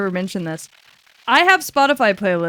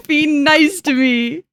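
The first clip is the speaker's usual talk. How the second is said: put-on whiny baby voice; 6 semitones higher, 3 dB louder, slower than usual. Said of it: "I'm a big fat fucking baby".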